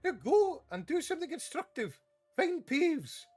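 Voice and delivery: barking orders. Acted. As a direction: send him off sharply. McGonagall ordering Filch.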